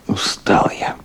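breathy whisper to himself, worn out; tired whisper. Acted. Exhausted man whispering to himself after a long shift. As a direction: sigh it out to no one.

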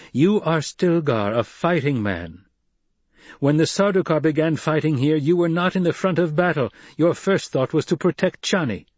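put-on Paul, commanding young leader. A narrator's reading. Paul confronting Stilgar over his conduct in battle, commanding. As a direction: press the charge firmly.

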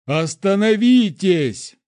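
high, loud, drawn-out shout; desperate yell. A narrator's reading. Guard commander trying to reach the prince, desperate yell. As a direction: yell to get through to him. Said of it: "заорал он, стараясь докричаться".

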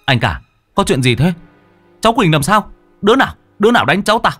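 alarmed shouting. A narrator's reading. Uncle running up from the kitchen, alarmed shouting. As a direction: demand who did it, voice climbing. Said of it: "ông quát".

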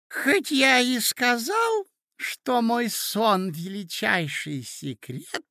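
high, slow character voice; doddering old prince. A narrator's reading. Prince answers the ladies about his dream, high and slow. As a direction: draw it out high like a befuddled prince.

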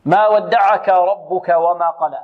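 very slow ما ودعك with thin, light letters; slow recitation. Shown as a demonstration. Teacher reciting the verse slowly to model it. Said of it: "الكلمة كلها مرققة".

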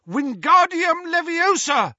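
desperate high, loud incantation; ringing high wand-casting cry; shouted spell. A narrator's reading. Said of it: "pointed his wand at the sidecar and shouted".

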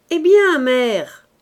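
loud, slow, furious call; angry shout. A narrator's reading. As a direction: hammer each word out in anger. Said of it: "cria-t-il de nouveau".

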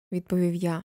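usual speaking voice

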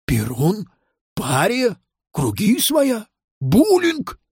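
bewildered questions, rising to a high pitch; astonished. Acted. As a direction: query word by word in growing disbelief. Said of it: "удивился Нил Нилыч".

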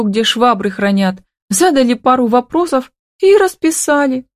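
high, hurt lament without real sobs; tearful wail. A narrator's reading. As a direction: pour out the grievance in a high, weepy voice. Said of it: "разревелась Камила".

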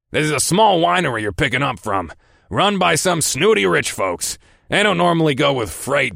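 high, gruff, emphatic boss voice. Acted. Boss describes snooty clients to his driver, gruff. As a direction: explain it like a scornful, gruff boss.

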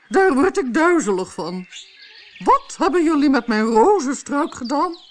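high, loud, imperious queen's voice; a bossy queen snapping at servants; angry queen. Acted. Queen demands what the gardeners are doing, high, loud and imperious.

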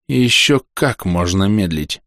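ordinary delivery, nothing marked

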